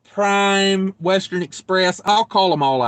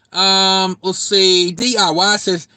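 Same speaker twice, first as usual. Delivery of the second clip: normal talking voice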